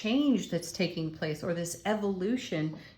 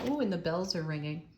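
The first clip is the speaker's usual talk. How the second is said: normal talking voice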